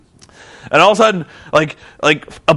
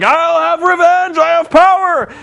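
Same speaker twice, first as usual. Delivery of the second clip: vengeful vow; 12 semitones higher, 3 dB louder than usual. Retold or imitated. Abyss holding bloodied Hogan, hammed-up vow. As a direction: swear revenge grandly. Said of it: "Abyss is like".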